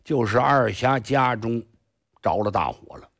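usual speaking voice

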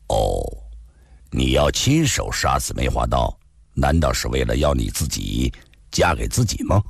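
low-pitched man's character voice; deep, measured dialogue voice; composed male hero, questioning. Acted. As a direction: drop well down in pitch and question her evenly.

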